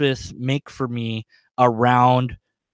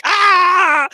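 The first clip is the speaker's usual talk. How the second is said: high, loud laughing shriek; shocked group yell; 7 dB louder than usual. Retold or imitated. The other players shrieking at the captured dragon, laughing yell. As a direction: shriek in mock disbelief, half laughing. Everybody else shouting in shock.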